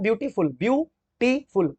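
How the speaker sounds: Beautiful slowly taken apart, view, टी, फुल; broken into syllables. Shown as a demonstration. Teacher showing students the break, beautiful said syllable by syllable. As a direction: slowly separate each part of beautiful. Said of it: "देखो break हो रहा है ना".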